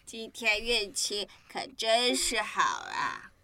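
low, slow contented drawl; slow satisfied sigh of a line; sleepy and slow. Acted. Friend wolf sleepy slow.

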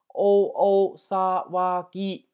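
teacher's slow, emphatic model of お騒ぎ; slow sounding-out. Shown as a demonstration. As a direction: sound out お騒ぎ slowly for learners, each sound held. Teacher sounds out new word slowly.

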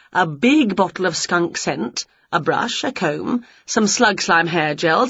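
usual speaking voice

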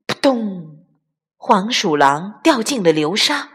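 plain reading voice

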